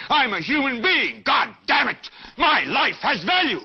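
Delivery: high, ringing shout of defiance; fervent, swooping preacher's shout; impassioned outcry. Acted. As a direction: cry out each phrase like a rallying call. Rousing viewers to defiant outcry.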